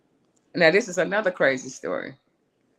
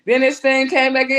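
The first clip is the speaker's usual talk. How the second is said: ordinary talk, nothing marked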